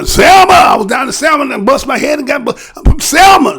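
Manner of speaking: loud, high mocking shout of an old activist; mock shouting veteran. Mocking the old guard on stream, a hammed-up shout. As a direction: bellow it like a proud old marcher.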